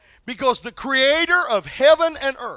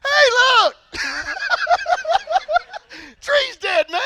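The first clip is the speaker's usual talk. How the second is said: excited shout. Retold or imitated. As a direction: blurt it out like a kid who just noticed. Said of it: "smart enough, goes".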